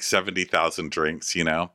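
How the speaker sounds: normal talking voice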